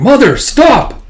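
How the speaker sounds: high, loud, drawn-out plea; desperate shout. Acted. Warlord begging her mother to stop, loud shout. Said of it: "the Warlord going".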